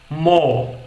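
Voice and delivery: "mo" with no R at the end, just M and O; British no R. Shown as a demonstration. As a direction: drop the R in "mo", like a cow's moo. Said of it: "como se não estivesse pronunciando o R". ("mo" sem R, britânico)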